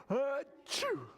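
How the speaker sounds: high, theatrical achoo; pretend sneeze. Storyteller acts out sick Bear, high pretend sneeze. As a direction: fake a big storybook sneeze. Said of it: "and he sneezes".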